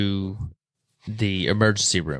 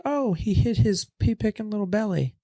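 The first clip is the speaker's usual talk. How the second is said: high, mocking voice of the onlookers; 12 semitones higher than usual. Onlookers in the field teasing the fallen player, high and mocking. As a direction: taunt him in a much higher pitch like the crowd did. Bystanders mocking him as he lies hurt.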